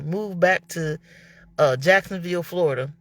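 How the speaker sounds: ordinary talk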